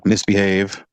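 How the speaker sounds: ordinary delivery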